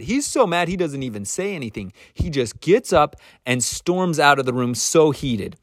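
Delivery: ordinary talk, nothing marked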